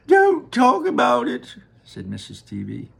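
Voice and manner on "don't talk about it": high, pained plea; distraught mother. Acted. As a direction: beg him to stop. Mother upset.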